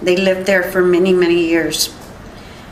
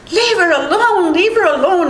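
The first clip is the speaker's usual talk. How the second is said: retold urgent command; 8 semitones higher than usual. Witness quoting husband ordering the dog off her, high and urgent. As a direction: re-enact a desperate order, pitched well up.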